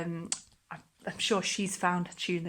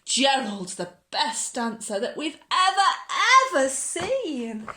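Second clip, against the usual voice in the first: much higher, louder awed cheer; amazed shouting; 11 semitones higher, 8 dB louder than usual. Acted. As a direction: cheer in wonder. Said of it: "They shouted".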